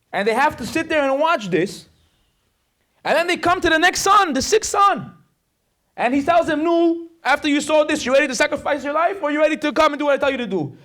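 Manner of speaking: loud, high dramatic storytelling; fiery preaching. Real, not acted. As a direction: build the horror at full force, pitched high. Story preached at full force.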